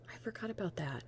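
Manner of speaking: whispered aside, no voice; hushed, almost to herself. Real, not acted. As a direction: breathe it out as a private aside.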